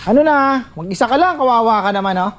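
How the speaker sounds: high-pitched call across to someone. Real, not acted. Checking on someone working alone, calling out. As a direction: call over to a lone worker, pitched well up. Calls out to lone worker.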